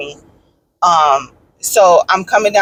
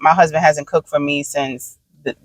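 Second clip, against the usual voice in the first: ordinary talk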